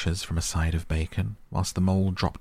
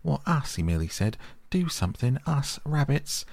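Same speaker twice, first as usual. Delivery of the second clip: silly rabbit; 9 semitones higher than usual. A narrator's reading. Otter mimicking the dim-witted rabbit, high and dopey.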